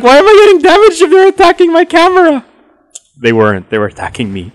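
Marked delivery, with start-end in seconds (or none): 0.0-2.4 high, loud baffled whine; high-pitched confused protest; confused outburst. Retold or imitated. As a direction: squeal out your confusion. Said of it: "I was like".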